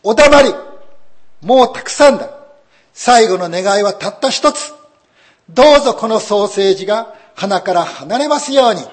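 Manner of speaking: wife with a sausage on her nose, furious; stamping fury. A narrator's reading. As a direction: throws a tantrum, then pleads in the same shrill rush. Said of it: "足をバタバタさせて言いました".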